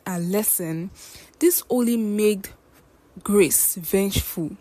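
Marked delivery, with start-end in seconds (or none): none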